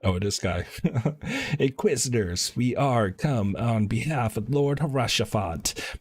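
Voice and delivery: ordinary delivery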